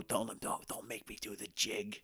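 hushed, pleading mock sob; mock crying plea. Retelling Chips begging Ope, hushed mock sob. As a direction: beg softly, half-crying. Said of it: "Crying like".

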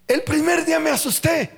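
hammed-up fright; mock fright. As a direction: exclaim it as if startled. Acts out the scare.

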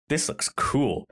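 normal talking voice

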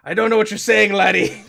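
loud, high Scottish retort; thick Scottish accent. Acted. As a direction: fire back in broad, loud Scots. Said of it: "replies with a thick Scottish accent".

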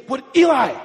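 high, loud acted yell; hammed-up shout at a child; mock outburst. Acts out outburst at child Eli.

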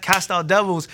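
ordinary talk, nothing marked

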